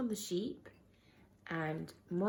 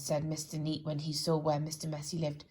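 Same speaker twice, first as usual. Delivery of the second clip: ordinary delivery, nothing marked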